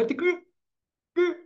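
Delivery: demonstrating a stammer that blocks the word; stuttering demo. Shown as a demonstration. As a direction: act out a stutter that won't let the words come. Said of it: "O gago é quando ele, além de puxar, não sai".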